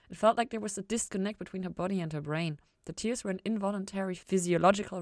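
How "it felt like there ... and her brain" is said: usual speaking voice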